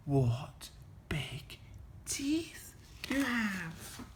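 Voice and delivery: put-on slow, hushed girl's whisper. A narrator's reading. Little Red noticing Granny's teeth, slow whisper. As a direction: whisper it word by word. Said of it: "Little Red whispered".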